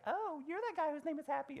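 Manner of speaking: high, airy imitation of college girls; girls teasing. College girls meeting Happy, high girlish mimicry. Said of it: "the girls would be like".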